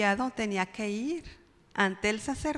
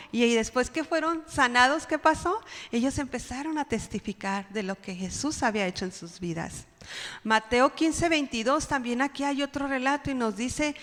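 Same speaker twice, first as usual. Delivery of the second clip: ordinary talk, nothing marked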